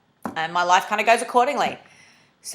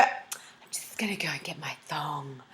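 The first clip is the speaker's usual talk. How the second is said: whispered quote; 4 semitones lower, 12 dB quieter, slower than usual. Retold or imitated. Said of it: "So when you go".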